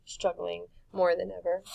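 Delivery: ordinary delivery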